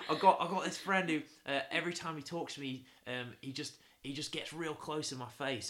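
put-on amused, amateur Aussie accent; bad Australian accent. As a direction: imitate an Australian bloke phoning in. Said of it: "I can't do an Australian accent".

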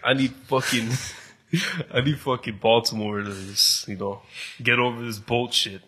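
ordinary talk, nothing marked

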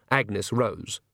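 ordinary delivery, nothing marked

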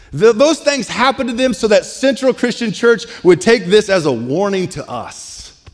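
high, emphatic sermon delivery; forceful preaching. Real, not acted. Preacher applies the warning to his church, preaching forcefully. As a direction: press the warning on the congregation, pitched high.

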